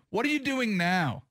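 challenging question leaning on "doing now"; stress on DOING NOW. Retold or imitated. Host challenging a candidate already in leadership, "doing now" stressed. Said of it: "instead of what are you going to do".

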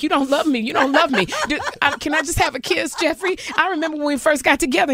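high-pitched, needy pleading; hammed-up wounded clinginess; jilted lover. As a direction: plead like a dumped partner, half laughing. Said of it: "like a boyfriend who doesn't wanna be with me".

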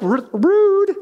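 sudden high yelp of rude; blurted rude. Retold or imitated. Complaining about doors left ungrateful, high indignant yelp. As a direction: blurt it out, shocked and loud. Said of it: "I wanna say it, like, really loud".

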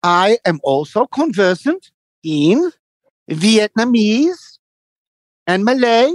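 high, very slow proud lecture; hammed-up boastful older man; mimicking him boasting. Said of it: "And he goes".

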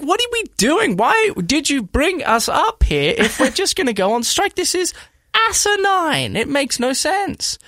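high-pitched retold frustration, slowing at the end; exasperated rant. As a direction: vent like you can't believe the league. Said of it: "And I'm like".

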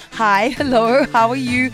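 ordinary delivery

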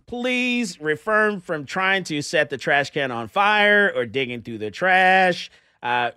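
loud stern warning over a speaker; lowering phone to yell. Real, not acted. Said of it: "Let me yell at someone".

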